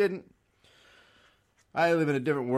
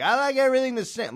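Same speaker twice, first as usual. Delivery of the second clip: whiny traditionalist; 14 semitones higher, 8 dB louder, faster than usual. Retold or imitated. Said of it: "The people who are like".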